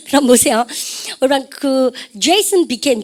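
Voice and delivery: usual speaking voice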